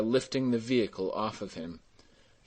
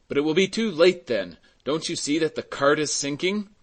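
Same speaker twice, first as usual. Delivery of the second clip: higher, louder urging; urgent protest; 6 semitones higher, 5 dB louder than usual. A narrator's reading. Mayor to staring peasants as cart sinks, urgent protest. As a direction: press them, time is running out.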